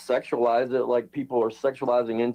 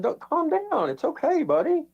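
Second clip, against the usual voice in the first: 1 mock reassurance; 13 semitones higher, slower than usual. Mock soothing opponent.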